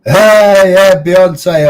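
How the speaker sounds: high, loud burst of hello; excited greeting. Real, not acted. Streamer greeting a viewer, loud and delighted. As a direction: greet her like a long-lost friend.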